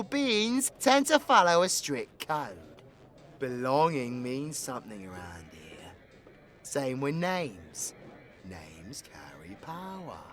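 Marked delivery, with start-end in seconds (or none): 3.4-10.2 low and hushed, slowing down; grave, soft, deliberate warning; solemn warning. Acted. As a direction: sink toward a near-whisper, full of weight. Solemn warning about the code.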